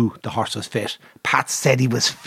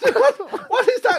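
very high-pitched incredulous squawk; retold outraged owner, half laughing; mock exasperation. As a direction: demand an explanation in a squeaky high voice. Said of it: "I'm supposed to be excited".

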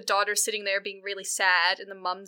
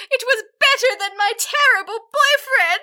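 high, loud wail through laughter; melodramatic daughter; 13 semitones higher, 7 dB louder than usual. Retold or imitated. Daughter sulking at her father about the galley, high and loud. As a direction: overdo the teenage drama. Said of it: "She's like".

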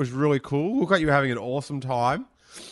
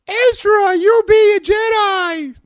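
screeching Ewok and Loth cat hybrid; annoying creature voice; 13 dB louder, slower than usual. Acted. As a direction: shout it very high and loud. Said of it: "they could talk like it".

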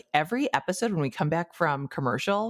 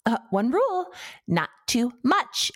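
put-on snooty preppy girl; 8 semitones higher than usual. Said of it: "holds it up ... and goes".

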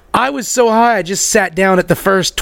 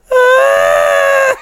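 high-pitched mock shriek; long, very high retold scream; panicked scream; 4 dB louder than usual. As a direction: squeal in hammed-up terror. High self panics at news, retold.

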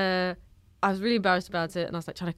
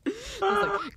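ordinary talk, nothing marked

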